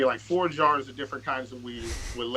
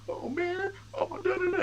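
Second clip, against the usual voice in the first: very high, hammed-up whimpering wail; mock crying girlfriend. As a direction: imitate his girlfriend bawling, overdone. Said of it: "she start crying".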